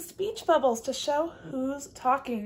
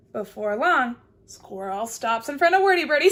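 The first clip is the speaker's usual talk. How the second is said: ordinary delivery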